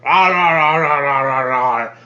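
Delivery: loud, repeated roar of "rah"; long roaring yell that tails off; yelled monster roar. Acted. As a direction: bellow like an angry brute. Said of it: "he yells".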